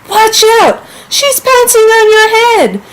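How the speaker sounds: high, loud warning shout; urgent exclamation. Shown as a demonstration. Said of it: "I want someone to listen to me".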